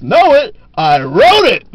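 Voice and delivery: loud, high punchline yell; punchline shout. Acted. Pianist shouting back at the patron, loud and proud. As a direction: belt the punchline out.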